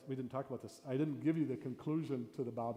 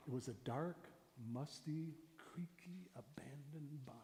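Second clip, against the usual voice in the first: words fade to a soft whisper; hushed storytelling; 10 dB quieter than usual. Shown as a demonstration. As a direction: pull listeners in with a dwindling whisper. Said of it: "I give some examples".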